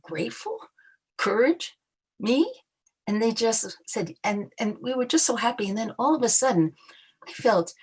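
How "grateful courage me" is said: disbelieving questions, pitch rising higher; incredulous echo. Retold or imitated. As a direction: echo their words back, stunned they'd say that. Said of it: "And I, I said".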